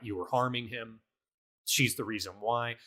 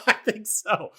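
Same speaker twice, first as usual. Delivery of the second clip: laughing; 11 semitones higher, 5 dB louder than usual. Real, not acted. Laughing at Balthazar meeting Balthazar.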